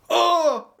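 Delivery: sudden high shout; test shout. Pretend. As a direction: yell out on request. (huuto pyynnöstä)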